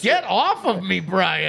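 slow mock TV judge; Judge Judy. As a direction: echo the stern judge line slowly. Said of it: "say it like Judge Judy".

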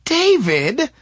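high, loud startled call; shout of recognition. A narrator's reading. April recognizing her classmate's voice, shouting in surprise. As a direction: call out his name as you recognize him. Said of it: "shouted April, recognizing the voice".

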